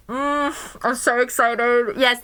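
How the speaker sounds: ordinary talk, nothing marked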